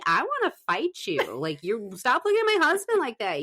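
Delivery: wife jokingly scolds the cat for eyeing her husband; mock jealous scolding. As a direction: playfully warn a cat off your husband.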